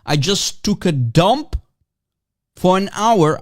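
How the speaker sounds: ordinary delivery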